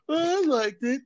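high-pitched mock defender; mocking co-host imitation. Teasing co-host over defending the movie, high mocking imitation. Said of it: "you kept saying".

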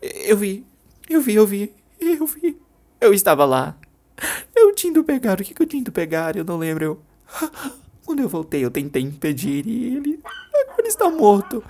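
high, tearful sobbing voice; a mother breaking down in tears. Acted. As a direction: cry it out in pieces. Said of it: "As lágrimas saíam do rosto".

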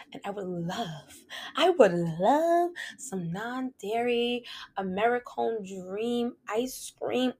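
breathy whispered craving for ice cream. Real, not acted. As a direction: whisper it like a guilty secret.

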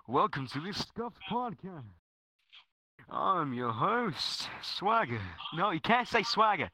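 grand, swooping announcer intro; put-on podcast host voice. Scuffed King opening the podcast, grand host voice. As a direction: announce it with big rises and falls. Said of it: "hard to say Scuffed in my voice".

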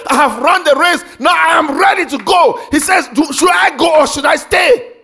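loud, high preaching voice; fervent sermon shouted from the pulpit; full-force preaching. Acted. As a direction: preach it out to the congregation.

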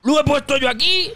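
imitating Chicote.

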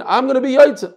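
mocking. Mocking the man who thinks a child's reading counts. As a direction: send up his smug assumption. Mocks the careless listener's assumption.

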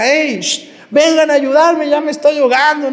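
high, loud cry for help; acted panicked call from the water; drowning man's shout. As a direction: yell to the boat as if going under. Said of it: "No les va a decir a sus compañeros".

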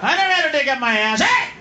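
high, shouted mock outrage; hammed-up furious protest; upset indignant star. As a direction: imitate an offended celebrity yelling denials. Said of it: "He got all upset".

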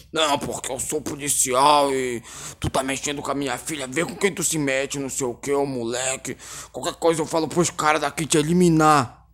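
low, muted imitation of a bullying policeman; hushed, deep-voiced parody of a menacing father; mock threatening man. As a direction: grumble the threats quietly, mocking the tough guy. Mimicking the man who threatened him.

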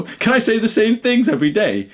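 high, put-on whine mimicking a lazy complainer; mocking whiny voice. Mocking people who can't list three things, in a high whine. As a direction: sneer it back in a silly high voice. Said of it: "they have the audacity to say".

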